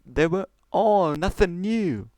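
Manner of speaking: usual speaking voice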